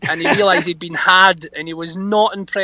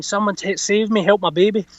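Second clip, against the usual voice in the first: normal talking voice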